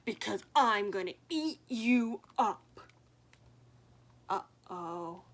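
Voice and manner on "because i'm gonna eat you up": louder menacing troll voice; troll roar. Acted. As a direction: threaten like a hungry troll. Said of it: "roared the troll".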